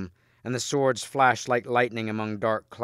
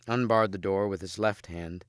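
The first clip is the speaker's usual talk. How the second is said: ordinary delivery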